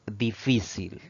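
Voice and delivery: FÍ of "difícil" given extra weight; "difícil" stressed on its second syllable; penultimate stress. Shown as a demonstration. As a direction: lean on the middle syllable of "difícil". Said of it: "La mayor fuerza de voz al pronunciarla recae".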